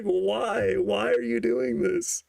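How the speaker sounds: high, slow, giggly question; drawn out through laughter; laughing. Retold or imitated. Pressing the friend while tripping, slow giggling question.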